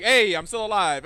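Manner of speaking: loud, slow, drawn-out hello; mock-cheerful phone greeting; imitated mom greeting. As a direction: announce yourself as mom, high and loud. Voices mom on Sunday call.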